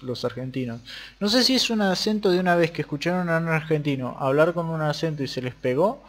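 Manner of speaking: normal talking voice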